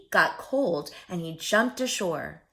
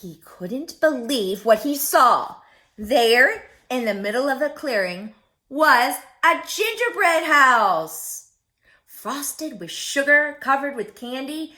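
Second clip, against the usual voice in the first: amazed wonder; 3 semitones higher, 8 dB louder than usual. A narrator's reading. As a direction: marvel at the candy house. Amazed description of the house.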